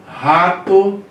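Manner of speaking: "rato" with a strong guttural R, like Portuguese rato; strong R sound. Shown as a demonstration. Teacher shows students the strong-breathing R in "rato". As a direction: open "rato" on a hard throaty R. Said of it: "ele tem um som de R forte".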